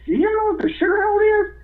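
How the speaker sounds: high, sing-song trick question; sly teasing George. Retold or imitated. George, setting up the rookie for a prank, sly high tease.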